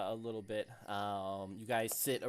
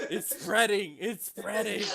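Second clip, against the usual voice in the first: high, loud outcry through laughter; laughing mock alarm; 10 semitones higher, 8 dB louder, slower than usual. Real, not acted. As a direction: cry out in giggling mock alarm. Joking alarm at spreading accent.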